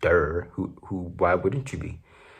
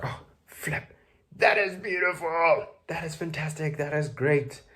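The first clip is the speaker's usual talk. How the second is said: mock gushing; 9 semitones higher than usual. Gushing over imagined relocation news.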